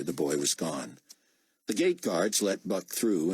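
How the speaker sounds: plain reading voice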